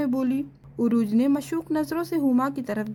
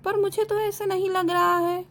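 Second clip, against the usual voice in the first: skeptical teacher; 6 semitones higher than usual. A narrator's reading. Teacher doubts student.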